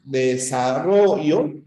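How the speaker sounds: "Desarrollo" slow, with the stressed syllable "rro" pushed; stressed demo word. Shown as a demonstration. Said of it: "simplemente la fuerza de voz".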